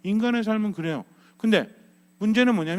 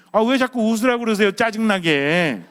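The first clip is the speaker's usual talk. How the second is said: 큰 소리로 투덜대는 짜증 섞인 항의; 3 semitones higher, 4 dB louder than usual. Acted. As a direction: complain loudly, dragging out the last word in annoyance. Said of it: "짜증이 있기 때문입니다". (짜증스럽게 따지며 끝말을 길게 끌기)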